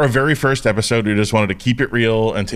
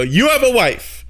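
mock shock; 11 semitones higher, slower than usual. His own shocked retold reply.

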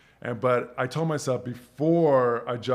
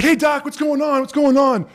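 loud greeting; 13 semitones higher, 11 dB louder than usual. Retold or imitated. Guy bursts in through door.

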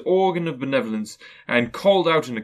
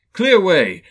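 loud, merry call; loud echo; 6 semitones higher, 9 dB louder than usual. Acted. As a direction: echo the order gleefully. Narrator echoes Fezziwig's cry.

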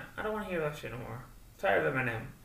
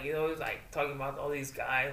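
ordinary talk, nothing marked

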